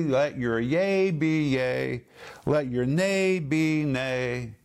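slow, deliberate emphasis on each phrase; measured, drawn-out insistence; emphatic. Retold or imitated. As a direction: stretch YEA and NAY out firmly. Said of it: "He says emphatically".

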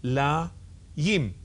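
Ye-ru-sha-la-yim, each syllable slow and separate; staccato. Shown as a demonstration. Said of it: "read that syllable by syllable".

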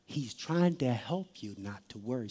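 low, very soft sermon delivery; intimate, subdued preacher; hushed preaching. Real, not acted. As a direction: drop down low and comfort the congregation quietly.

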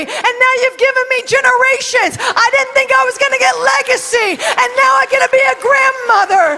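builds to a high, loud shout of joy; ecstatic praise. Acted. Ruth praising God for grandchildren, building to a shout. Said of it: "Ruth is like".

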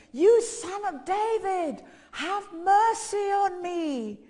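loud, high, long-drawn shout; desperate cry, every word stretched out; insistent shouting. Retold or imitated. Undeterred beggar calls to Jesus, shouting harder. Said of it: "he kept shouting all the more".

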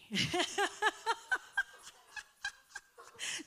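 long high bursting laugh. Real, not acted. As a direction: let a real laugh spill out. Laughs at own joke.

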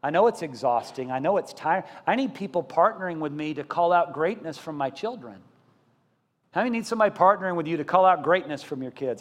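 ordinary delivery

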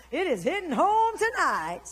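loud, high, drawn-out exclamation; exclaimed teasing. Real, not acted. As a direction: call it out slowly to the whole room. Teases congregation, then laughs.

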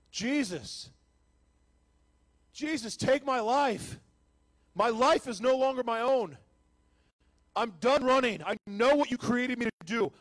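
high, loud, slow shouted surrender; desperate cry to Jesus. Retold or imitated. Alone in a jail cell, surrendering to Jesus with desperate loud cries. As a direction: cry out with everything left.

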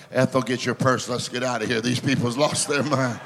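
lightly voiced skeptic leaving church; mock churchgoer. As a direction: mutter like a spooked old churchgoer wanting out. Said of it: "some of y'all say".

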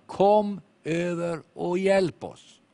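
slow, drawn-out plea for help; man calling out. Retold or imitated. Preacher voicing the Macedonian man's slow plea. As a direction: call across the water, slowly begging. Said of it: "som ropar".

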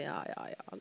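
whispered, brushing it off; dismissive whisper. Retold or imitated. Turning her back in bed, dismissive whisper. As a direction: shrug it away under your breath. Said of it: "snudde ryggen til og tenkte".

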